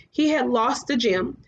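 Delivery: ordinary delivery, nothing marked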